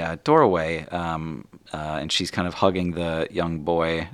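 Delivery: normal talking voice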